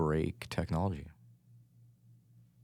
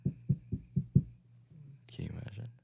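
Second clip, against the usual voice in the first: whispering; much slower than usual. Pretend. Mock dramatic whisper.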